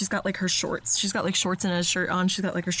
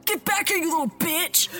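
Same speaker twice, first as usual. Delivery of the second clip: yelling; 10 semitones higher than usual. Acted. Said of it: "yells after Mike".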